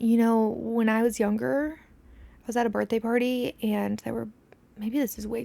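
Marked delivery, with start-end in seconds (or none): none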